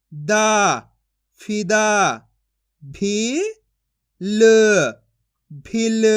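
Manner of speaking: slow, syllable by syllable, each sound separated; teacher sounding out letters for young pupils; phonics drill. Shown as a demonstration. Teacher reads Hindi syllables to class, slow and deliberate.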